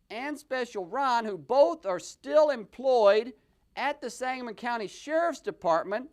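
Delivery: ordinary talk, nothing marked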